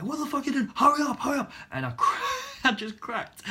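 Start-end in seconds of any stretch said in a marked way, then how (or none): 0.0-1.5 high-pitched, loud shouted hurrying; relentless badgering. Instructor keeps on at stalled learner, acted angry shouting. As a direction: imitate an instructor hounding a learner. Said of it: "he kept on".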